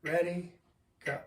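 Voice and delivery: One, ready, go called to start; count-in. Shown as a demonstration. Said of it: "Here we go".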